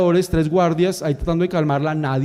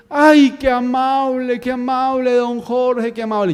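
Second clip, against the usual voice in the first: put-on grateful old lady; 6 semitones higher, slower than usual. Grandmother thanks him warmly.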